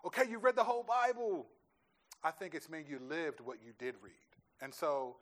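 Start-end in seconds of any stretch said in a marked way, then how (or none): 0.1-1.5 higher, louder mock pat on the back from God. Pastor mocking the idea that God keeps score, higher and louder. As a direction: play a hammed-up God ticking a box. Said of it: "for God it's like".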